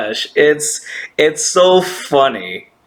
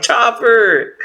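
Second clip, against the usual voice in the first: higher-pitched mock scolding cry; exasperated call; 9 semitones higher than usual. Viewer to Chopper punking out, exasperated call. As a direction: call the name out in comic exasperation.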